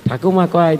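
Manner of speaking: ordinary delivery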